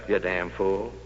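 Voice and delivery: muttered low and soft; under my breath. Retold or imitated. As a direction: say it to yourself so nobody hears. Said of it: "And then under my breath".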